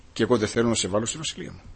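ordinary talk, nothing marked

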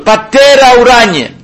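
fervent call. Acted. Preacher lifts voice calling God.

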